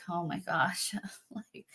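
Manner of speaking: breathless whisper with a little laugh; panicked whisper. Retold or imitated. To herself, freaking out over the phone call, whispered. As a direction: whisper it like you can't believe it. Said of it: "freaking out because I'm like".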